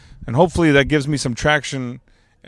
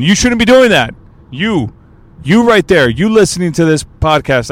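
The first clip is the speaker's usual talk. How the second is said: loud, high-pitched playful telling-off; mock scolding; 5 semitones higher, 6 dB louder than usual. Podcaster catching a listener texting while driving, mock scolding. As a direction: call out the culprit directly.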